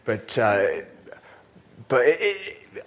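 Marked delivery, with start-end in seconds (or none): none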